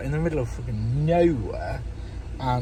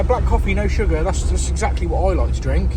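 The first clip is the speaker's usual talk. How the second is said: ordinary delivery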